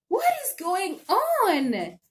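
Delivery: high, exasperated shouting at the refund; mad outburst. Retold or imitated. Telling Amazon off over the tiny refund, high and exasperated. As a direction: demand to know what is going on, fed up. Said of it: "I was so mad".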